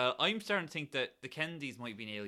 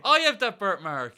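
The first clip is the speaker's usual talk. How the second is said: slow, loud soap-opera reveal; dramatic reveal; 6 semitones higher, 13 dB louder, slower than usual. Acted. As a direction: announce it like a melodrama twist.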